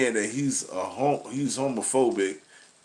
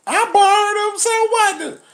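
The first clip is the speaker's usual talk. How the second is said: much higher, louder, brassy woman caricature; high, loud, defiant woman impression; 8 dB louder than usual. Woman voiced in dialogue.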